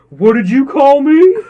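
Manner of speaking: loud, high, drawn-out indignation; mock outrage. Customer confronting a Boston guy at ice cream parlor, mock outrage.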